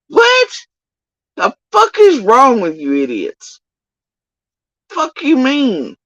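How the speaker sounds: high-pitched, incredulous outburst; indignant challenge. Acted. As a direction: confront them, voice leaping up in disbelief. Indignant challenge thrown at absent accusers.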